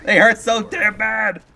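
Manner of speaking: high, loud, hammed-up yelp of pain; retold pained cry. Batter whose hands hurt after the hit, exaggerated pained wail. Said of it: "and I'm like".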